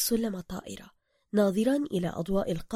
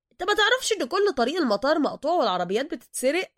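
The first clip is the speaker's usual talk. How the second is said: pushy Cairo driver; 7 semitones higher, 5 dB louder than usual. Acted. Driver character voice.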